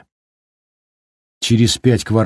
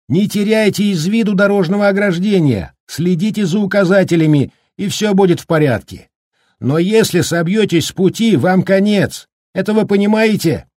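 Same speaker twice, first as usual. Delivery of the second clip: кричит высоко, перекрывая ветер; предупреждать криком сквозь бурю; почти крик; 10 semitones higher than usual. Acted. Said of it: "приходилось почти кричать". (почти кричит на ветру)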